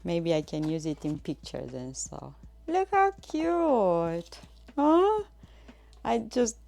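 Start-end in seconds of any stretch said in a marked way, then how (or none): 2.7-5.2 high, very slow cooing; gushing. Real, not acted. Streamer showing viewers a tiny basket, high and gushing. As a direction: coo over something adorable.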